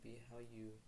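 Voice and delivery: ordinary talk, nothing marked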